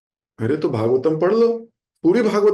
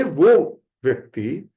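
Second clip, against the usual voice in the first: ordinary talk, nothing marked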